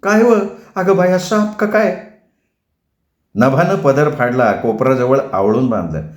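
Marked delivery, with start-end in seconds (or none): 0.0-2.1 high startled cry at a snake; scared village woman calling out; frightened cry. Acted. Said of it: "मोठ्यांदा ओरडली".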